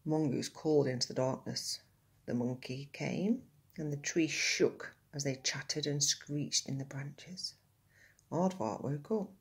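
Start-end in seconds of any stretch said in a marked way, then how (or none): none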